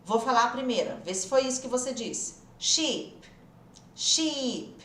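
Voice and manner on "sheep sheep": Sheep with a long, stretched "ee"; exaggerated long vowel. Shown as a demonstration. Teacher exaggerates so students can identify it, long ee. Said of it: "Eu tô exagerando aqui".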